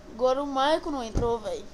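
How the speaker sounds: ordinary talk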